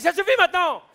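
high, loud furious yell; angry cop shout. Retold or imitated. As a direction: snap and bark at the driver. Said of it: "il a pété un câble".

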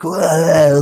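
high, loud mock wail; hammed-up frenzied yell; kids going nuts. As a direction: imitate wild kids with a yelled "wah". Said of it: "they're going nuts".

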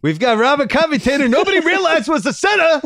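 put-on high, loud, strained old-politician voice; Bernie again. Cohost slips back into Bernie as Rockets coach, high and loud. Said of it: "my impersonation of Bernie".

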